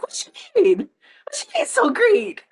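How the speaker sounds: very high, loud, squeaky disbelief; hammed-up high squeal of outrage; incredulous squeak. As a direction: squeal it like you can't believe your ears. Incredulous reply to Uncle Pono.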